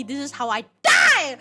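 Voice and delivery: loud, falling cry of joy; overjoyed fangirl shout; excited shout. Real, not acted. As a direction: yell it to the ceiling. Fangirl crying out to friend.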